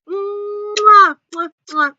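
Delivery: smack out big kisses; blown kisses. Real, not acted. Vlogger signing off to viewers, blowing kisses.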